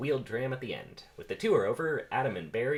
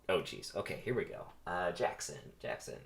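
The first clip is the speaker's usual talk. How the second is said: ordinary delivery, nothing marked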